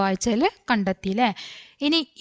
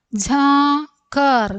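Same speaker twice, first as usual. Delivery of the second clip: slow, higher, stretched syllables; 4 semitones higher, much slower than usual. Shown as a demonstration. Teacher introducing a new word to learners, syllable by syllable. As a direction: draw out each half of the word.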